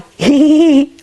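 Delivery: high-pitched laugh at own joke; high giggle. Real, not acted. Teller amused by his own kangaroo joke, high giggle. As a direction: giggle away in a high squeal.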